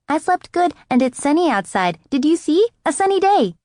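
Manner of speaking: put-on high, loud happy child; excited little girl. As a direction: bubble over like a small kid. Gabby excited about sunny day.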